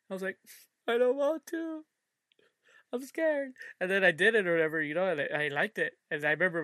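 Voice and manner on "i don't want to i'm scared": high, whiny reluctant plea; much higher pitched, pleading and scared; scared, tearful child. Retold or imitated. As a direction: imitate a frightened kid refusing. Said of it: "I had tears in my eyes".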